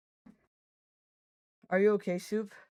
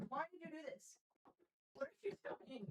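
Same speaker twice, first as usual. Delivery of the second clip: pet-talk voice; 7 semitones higher, 19 dB quieter than usual. Real, not acted. Talking to cat, high pet voice.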